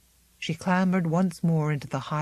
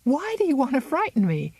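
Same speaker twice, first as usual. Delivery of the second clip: forced light cry; 9 semitones higher, faster than usual. Acted. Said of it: "cried Miranda, trying to laugh".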